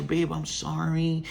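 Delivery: soft, slow, drawn-out fake apology; syrupy mock apology; mock grovelling. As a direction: plead in a hammed-up whimper. Mocking himself as a grovelling boyfriend.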